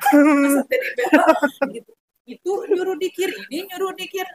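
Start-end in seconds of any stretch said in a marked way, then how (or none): 0.0-1.3 laughing through the words; half-laughing, amused at herself. Real, not acted. Telling her friend, laughing. Laughing while repeating complaint.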